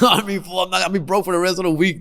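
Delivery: ordinary talk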